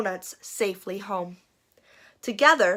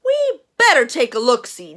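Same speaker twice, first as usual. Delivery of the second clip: sheriff voice; 2 semitones higher, 7 dB louder than usual. Acted. Sheriff voiced in read-aloud story.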